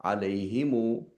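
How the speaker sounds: Alayhimu said slowly; easy version. Shown as a demonstration. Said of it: "which is easier to pronounce?".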